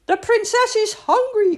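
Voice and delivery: high, loud excited maid; excited cry. A narrator's reading. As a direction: call it out happily. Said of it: "cried the maid".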